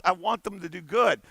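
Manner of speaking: ordinary talk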